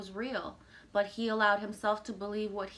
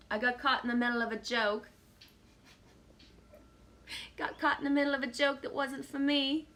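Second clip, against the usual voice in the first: a wobbly put-on British accent, rising higher; bad British accent; 6 semitones higher, 4 dB louder than usual. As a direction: play at a British accent that doesn't quite land. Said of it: "It's not a good accent".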